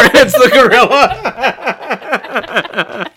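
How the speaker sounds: slow, high, laughing reveal; laughing so hard the words stretch out. Real, not acted. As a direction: crack up on the punchline.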